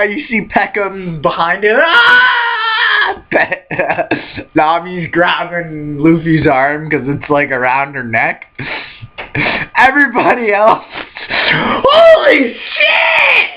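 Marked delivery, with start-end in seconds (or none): 1.8-3.2 shrill high shriek of terror; high scream. Retold or imitated. Peckham voiced screaming. 11.3-13.5 very high, slow drawn-out scream; shocked scream. Retold or imitated.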